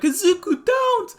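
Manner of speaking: high, loud frightened cry; desperate plea. Acted. Koda begging Izuku not to fight, high and loud. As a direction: beg him not to go.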